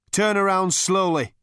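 loud, high hectoring command; barked order. A narrator's reading. Captor with a gun ordering two intruders, barking. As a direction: bark it like an armed guard. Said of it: "barked the voice".

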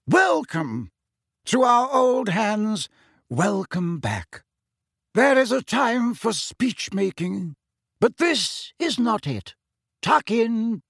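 high, slow, ringing welcome. A narrator's reading. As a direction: proclaim it grandly to the hall. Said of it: "said Dumbledore in a ringing voice".

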